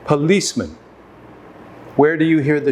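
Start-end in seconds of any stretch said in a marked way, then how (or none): 0.1-0.8 "Policeman" as puh-LEECE-mun, schwas either side; middle-stressed "Policeman" with weak outer vowels; schwa demonstration. Shown as a demonstration. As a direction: say "Policeman" carefully, first vowel reduced to uh. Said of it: "listen again as I pronounce".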